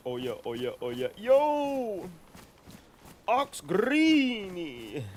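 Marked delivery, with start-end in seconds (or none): 1.2-5.0 high, loud, drawn-out call; excited greeting shout. Real, not acted. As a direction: hail the friend across the room, slowly. Greeting a viewer by calling out.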